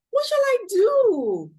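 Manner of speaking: high wailing cry of despair; old woman wailing. A narrator's reading. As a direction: wail it like a fretting old woman. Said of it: "The old woman cried".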